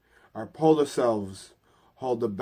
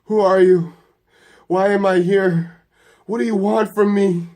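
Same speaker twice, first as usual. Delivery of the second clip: loud, high-pitched panicked demands; fearful yelling; 8 semitones higher, 11 dB louder than usual. A narrator's reading. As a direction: yell it out of fear. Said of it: "he starts yelling".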